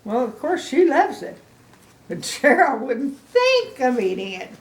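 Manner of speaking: usual speaking voice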